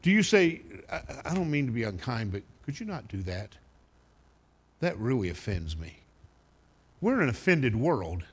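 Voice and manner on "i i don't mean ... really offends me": polite objection. Shown as a demonstration. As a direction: gently model how to object without being unkind. Models a polite objection to swearing.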